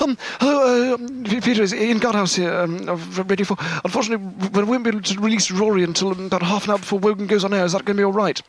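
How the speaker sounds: high, posh royal impression on the phone; Prince Charles voice. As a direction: put on the prince as the caller. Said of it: "I rang up Peter in Scotland and said".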